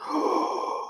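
high breathy gasp of shock; sharp gasp. Real, not acted. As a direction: gasp in disbelief. Reacting to McGregor's earnings.

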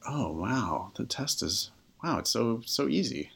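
softly imitated dawning wonder; understated, marvelling realisation; quiet amazement. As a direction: act out a student's hushed revelation. Student walks away amazed, acted.

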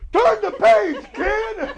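high, loud page-turn yell. Retold or imitated. Imitating the guy on a kids' tape, yelling high and loud. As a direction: bellow it as a sudden startling command. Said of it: "when you hear this guy yell".

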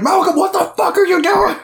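very high, squealing freak-out; hammed-up high-pitched alarm; mock panic. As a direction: imitate friends in a total panic. Acting the panicked friends watching roulette.

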